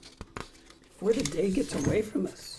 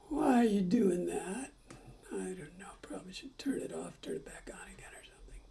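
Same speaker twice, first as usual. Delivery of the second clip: whisper; 7 dB quieter than usual. Real, not acted. Muttering at the camera.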